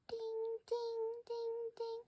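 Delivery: high thin alarm-clock dings; imitated alarm ding. Narrator mimics her morning alarm, high mock ding.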